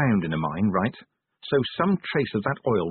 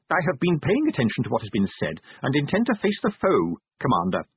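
high-pitched, prim little man standing firm; formal, earnest resolve, pitched high; earnest defiance; 4 semitones higher than usual. Acted. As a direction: declare it stiffly, voice pitched up. Timid clerk insists on facing battle.

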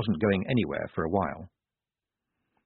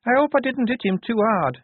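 put-on high, raised girlish voice; worried Shufti; 13 semitones higher, 5 dB louder than usual. A narrator's reading. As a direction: fret it high and anxious. Shufti worries after the blow.

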